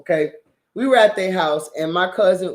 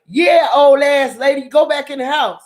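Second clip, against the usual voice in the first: put-on kid yelling insult; 7 semitones higher, 5 dB louder than usual. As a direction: shout the insult at the old lady. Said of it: "He was like".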